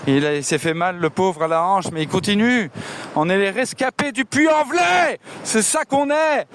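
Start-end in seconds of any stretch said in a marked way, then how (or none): 3.1-6.5 triumphant shout. Real, not acted. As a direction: shout it out like a victory chant. Proud rallying cry to his friend.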